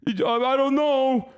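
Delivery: sneering impression of someone shrugging it off; dumb apologist voice. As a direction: play the clueless defender, mocking him. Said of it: "you're like".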